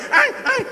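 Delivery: yelping in pain like a kicked dog; a sharp high puppy yelp. Retold or imitated. The kicked dog runs off, high pained yelps.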